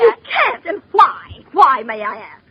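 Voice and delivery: tearful fury at the operator; outraged protest. Acted. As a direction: demand an answer, near tears.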